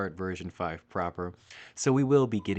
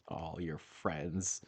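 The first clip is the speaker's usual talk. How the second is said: mock hurt; 5 semitones lower, 10 dB quieter, much slower than usual. Real, not acted. Mock hurt at being called friend.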